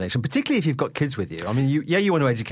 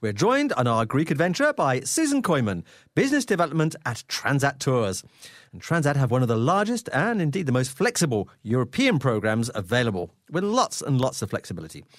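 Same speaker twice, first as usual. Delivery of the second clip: upbeat travel show host; 4 semitones higher than usual. Acted. Radio host opens segment.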